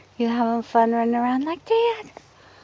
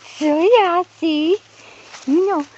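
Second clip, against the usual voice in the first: ordinary talk, nothing marked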